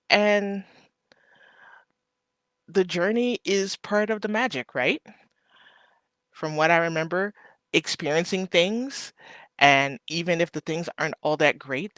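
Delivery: ordinary delivery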